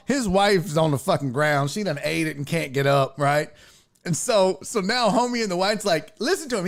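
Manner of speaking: ordinary talk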